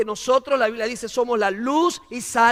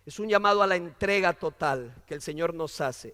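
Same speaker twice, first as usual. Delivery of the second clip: ordinary talk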